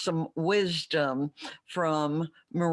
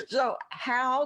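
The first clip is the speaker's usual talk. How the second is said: cracking up mid-line; 7 semitones higher than usual. Real, not acted. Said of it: "I'm laughing at my own joke here".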